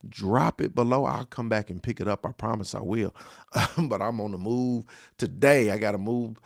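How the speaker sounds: ordinary talk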